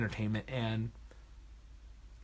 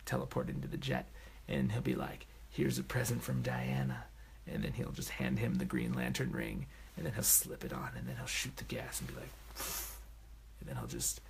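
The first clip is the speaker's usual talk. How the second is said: hushed, secretive whisper, much softer; conspiratorial whisper; 12 semitones lower, 9 dB quieter than usual. Acted. Fan whispers his crackpot theory to viewers, hushed conspiratorial whisper. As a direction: let the theory slip out in a whisper.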